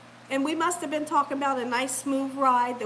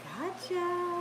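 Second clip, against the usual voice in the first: soft, high, drawn-out taunt; teasing gotcha; 4 semitones higher, 7 dB quieter than usual. Retold or imitated. Lady looking back right before it started, soft teasing. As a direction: imitate a sneaky quiet tease. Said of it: "She gonna do like this".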